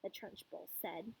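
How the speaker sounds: plain reading voice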